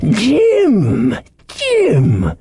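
high, pleased castaway savouring a name. A narrator's reading. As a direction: try the name over with pleasure, pitched high. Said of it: "quite pleased, apparently".

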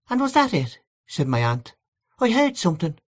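a hint of a sympathetic aunt; aunt agreeing.

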